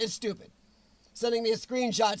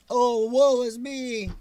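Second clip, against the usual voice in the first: slow, louder, hammed-up moaning; mock self-pity; 7 dB louder, slower than usual. Streamer brushing off the idea he's sulking, mock whining. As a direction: play up a whiny lament in mockery.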